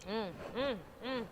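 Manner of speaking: savoring the food, mouth full; ASMR eating. Real, not acted. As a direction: mouth full, soft humming while chewing. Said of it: "Ini kita A S M R".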